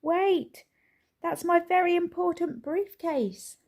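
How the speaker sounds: high loud protest; shouting. A narrator's reading. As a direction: holler it across the fair. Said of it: "shouted Mister Brown".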